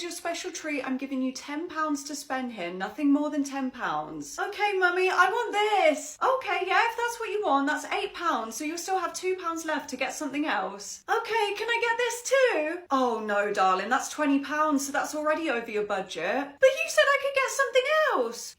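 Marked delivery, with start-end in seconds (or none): 4.4-6.2 put-on high, whiny little girl; child voice. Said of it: "ten-year-old". 11.1-12.8 put-on high, whiny little girl; child voice. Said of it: "ten-year-old". 16.6-18.6 put-on high, whiny little girl; child voice. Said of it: "ten-year-old".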